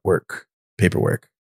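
ordinary talk, nothing marked

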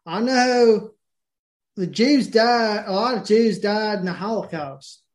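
put-on Southern accent. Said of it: "I'm gonna talk in the Southern accent".

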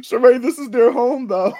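high, loud, laughing through it; laughing tease. Real, not acted. Reminding Sheree whose home it is, laughing. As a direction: tease her while cracking up.

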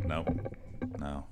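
normal talking voice

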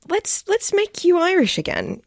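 high-pitched mock diplomacy; retold director's suggestion. Voices director's tactful suggestion.

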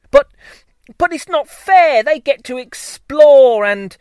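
high, loud protesting whine; whiny child bee. Acted.

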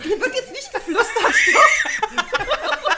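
loud, high laughing stop; laughing protest. Real, not acted. Host shouting down the whispering, laughing. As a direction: shout them down through laughter.

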